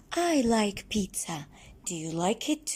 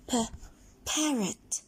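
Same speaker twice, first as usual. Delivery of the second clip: ordinary talk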